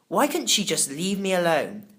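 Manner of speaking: ordinary delivery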